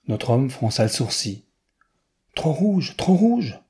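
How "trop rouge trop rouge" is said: high-pitched protest, said twice; exclaimed objection. A narrator's reading. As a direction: cry out in disapproval, pitch jumping up. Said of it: "s'écria-t-il". (protestation aiguë, en s'écriant)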